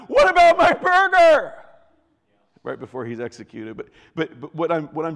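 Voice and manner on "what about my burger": very high, full-out scream. Acted. Condemned man right before he's executed, screaming. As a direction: scream it out in desperate protest. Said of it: "he's screaming out".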